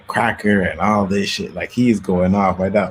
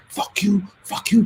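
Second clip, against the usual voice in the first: high-pitched imitated outburst; going off, cursing; 13 semitones higher than usual. Friend going off on the cops, high-pitched retold cursing. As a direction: curse them out in a raised, high pitch. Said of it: "he was going off on the cops".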